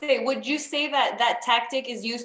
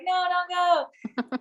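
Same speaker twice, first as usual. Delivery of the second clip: put-on childish plea; 7 semitones higher, slower than usual. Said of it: "I was like".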